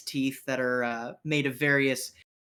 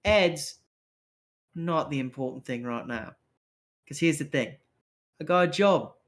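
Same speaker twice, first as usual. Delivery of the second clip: placating street rogue. Acted. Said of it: "He goes".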